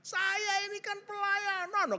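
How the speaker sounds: high, loud indignant protest; stretched, hammed-up indignant shout; retold snapping. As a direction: re-enact yelling in self-defence. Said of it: "Saya bentak dia".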